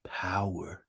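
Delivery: hushed emphatic whisper; whispered power. Real, not acted. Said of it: "I'm giving you nothing but".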